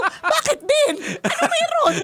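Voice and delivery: very high, puzzled what's going on; mock bewilderment, squeaky and high; bewildered. Wife baffled by husband's panic, high puzzled voice. Retold confused exchange.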